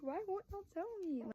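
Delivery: high-pitched mock exasperation; retold whiny insistence; imitated pleading demand. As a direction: plead as the sister left out of a secret. Retold sister demanding the secret.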